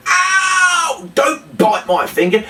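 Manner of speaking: high, hammed-up yelp of pain; mock pain cry. Entertainer pretends the puppet dog bit his finger, hammed-up yelp. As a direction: overplay the sting of a bitten finger.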